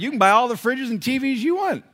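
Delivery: high, loud mock-generous dad; exclaimed retort. Dad retorting to son about fridges and TVs, loudly. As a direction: crow it at your kid. Retold comeback to his son.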